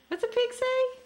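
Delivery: put-on squeaky high baby-talk question; high playful voice. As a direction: pitch it way up for the baby. Dad asks toddler animal sounds.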